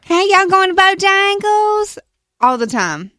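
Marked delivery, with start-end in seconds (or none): 0.0-2.0 high, slow Southern drawl; imitated Southern accent. Mimicking people who imitate Southerners, drawn-out Southern accent. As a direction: stretch out a mock Southern drawl. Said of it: "try to imitate Southerners".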